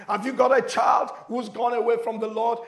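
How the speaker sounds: ordinary talk, nothing marked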